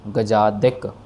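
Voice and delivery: गजादिक ending in a short "dik", not "dak". Shown as a demonstration. Teacher correcting गजादिक to dik for students. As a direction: say गजादिक with a clear short i in दिक. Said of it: "दक नहीं, दिक".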